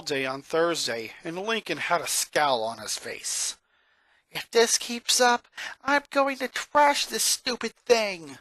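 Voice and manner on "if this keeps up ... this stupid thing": higher, fed-up muttering with a slow finish; boy griping that he'll trash it; annoyed grumble to himself. Acted. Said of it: "Lincoln said to himself".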